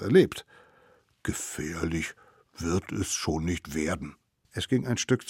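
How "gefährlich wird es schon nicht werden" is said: lower, soft thinking aloud; quiet musing. Acted. Mammoth deciding the crazy fellows are harmless, quiet musing. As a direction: reassure yourself under your breath.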